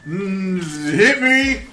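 put-on high, loud slurred drunk rambling; drunk wrestler impression. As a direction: stagger through it like a drunk. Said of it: "stumbling around and throwing together these completely incoherent sentences".

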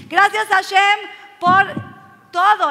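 loud, high, slowed climax; fervent thanks. Acted. Believer thanking Hashem for everything, fervently. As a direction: proclaim it slowly at full voice.

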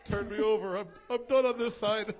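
playful loud joke. Acted. Joking he's cooking.

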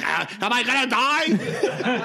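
high, drawn-out mock panic; mock hyperventilating. Friend on the couch panicking, mock hyperventilating. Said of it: "he's, like, sh-, hyperventilating".

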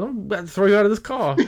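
high, quick mock Southern threat; imitated New Orleans indignation in dialect; Southern drawl. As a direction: mimic a fuming Southern driver. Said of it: "The Southern drawl was just out".

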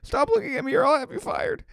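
very high whiny sob; mock crying. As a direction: whine it through fake tears. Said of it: "And I'd be like".

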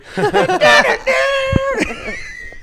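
high whooping laugh that breaks out; loud laughing shout. Real, not acted. Remembering pretending to be John Cena, a loud whooping laugh. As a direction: burst out laughing loudly.

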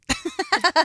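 high-pitched burst of laughter. Real, not acted. Host laughs over her half-remembered statistic, amused laugh. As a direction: laugh at your own vague claim.

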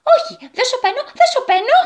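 very high, angry refusal; defiant shouting. Acted. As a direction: refuse to be hushed, crying out high. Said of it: "φώναξε πιο δυνατά". (δυνατή, θυμωμένη φωνή)

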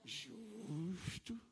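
mock musing. Voicing inner grumbling thoughts.

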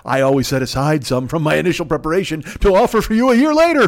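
hammed-up posh villain, rising to a gleeful cry; Count from Sesame Street. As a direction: start imperious, climb to a theatrical peak. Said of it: "I don't know why he's the Count from fucking Sesame Street".

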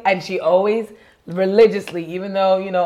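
usual speaking voice

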